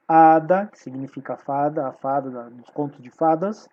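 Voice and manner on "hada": "hada" with a loud opening A; "hada" stressed hard on the first syllable. Shown as a demonstration. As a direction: punch the HA of "hada", silent h. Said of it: "é a tônica".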